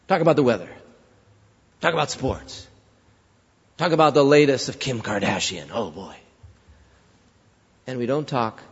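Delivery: usual speaking voice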